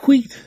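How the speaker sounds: high sharp whistle blasts voiced; whistle imitation. A narrator's reading.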